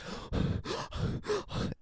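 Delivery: high, breathy mock gasp for air; a hammed-up gasp of someone hyperventilating. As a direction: imitate a panicked gasp.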